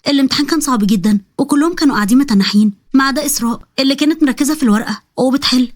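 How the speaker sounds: ordinary delivery, nothing marked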